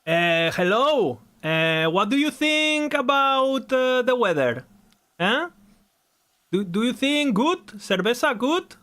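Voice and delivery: high, slow, hammed-up Spanish accent; exaggerated Spanish accent, stretched vowels; mock Spanish accent. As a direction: drawl it out like a caricature Spaniard. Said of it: "this is how normal Spanish people speak".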